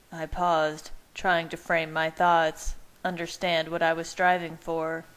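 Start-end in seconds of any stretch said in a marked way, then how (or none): none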